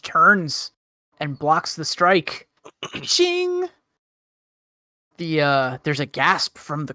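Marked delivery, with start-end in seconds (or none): 3.0-3.7 high ringing "ching" sound effect; imitated metallic clang. Game master voicing the blocked strike, high metallic ching. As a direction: sing out a high blade-clash noise.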